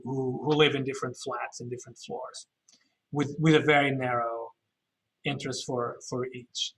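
ordinary talk, nothing marked

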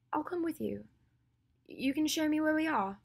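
high, thin, half-whispered offer. Acted. Hermione edges closer to Draco, pleading in a high half-whisper.

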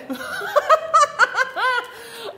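a burst of high delighted laughter; high squealing laugh. Real, not acted. Reader laughing at her own Canadian joke, high and delighted.

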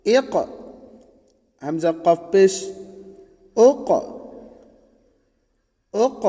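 জোরে, উঁচু গলায় বানান করে পড়ানো; ছাত্রদের সাথে বলাতে স্পষ্ট উচ্চস্বরে পড়ুন; উচ্চস্বরে বানান অনুশীলন. Shown as a demonstration. Teacher drills spelling aloud. (মক্তবের শিক্ষক ছাত্রদের বানান শিখিয়ে উচ্চস্বরে পড়াচ্ছেন)

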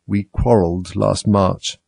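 plain reading voice

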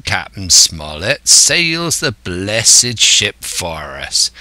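rolling West Country pirate voice; old pirate, scheming. Acted. Silver explaining his plan to the crew, as scheming pirate. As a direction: expound like a crafty old sea-dog.

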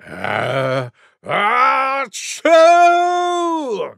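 breath in, then a high loud explosion; huge mock sneeze. Wild Boar showing how huge a sneeze is, huge mock sneeze. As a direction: wind up, then let a long achoo fly. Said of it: "An elephant sneeze must be huge".

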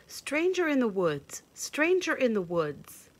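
high, loud bird cry; blue jay call. Acted. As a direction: call it out like a jay warning the woods. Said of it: "The blue jays cawed a warning".

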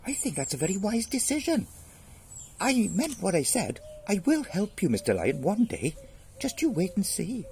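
high mouse voice; earnest little mouse. Acted. Spared mouse promising help, high and earnest. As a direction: promise keenly as a tiny mouse.

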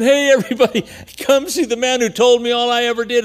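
high, loud eager call to the town; hammed-up excited woman calling out. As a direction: call the whole village over, thrilled. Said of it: "you say to the men".

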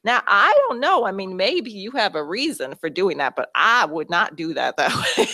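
high, chuckling Southern coach giving blunt advice; Southern accent. Retold or imitated. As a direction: imitate the coach kindly disapproving, with a Southern lilt. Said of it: "And she said".

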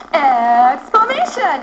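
loud, drawn-out excited chant; emphatic, slow exclamation demo; really excited. Shown as a demonstration. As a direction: stretch it out with big excitement. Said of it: "say it really excited".

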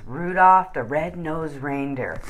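ordinary delivery

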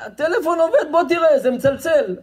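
very high-pitched insisting seller; heated insistence. Acted. Acted argument over working phone.

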